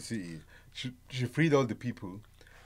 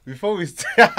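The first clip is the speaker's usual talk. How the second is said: higher, louder, laughing through it; laughing restart; 7 semitones higher, 11 dB louder, slower than usual. Real, not acted. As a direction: attempt the intro while cracking up. Intro spoiled by laughter.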